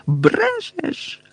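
very high, drawn-out accusing squeal; shriek. A narrator's reading. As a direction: shriek it high without much volume. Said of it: "вереснула вона". (високий вереск)

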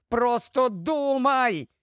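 high, drawn-out urging; urgent cry. Acted. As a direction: call it out slowly while being pulled away. Shouts while pulled into bookcase.

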